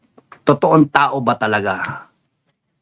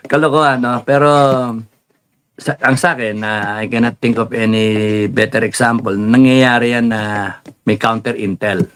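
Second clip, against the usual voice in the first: ordinary talk, nothing marked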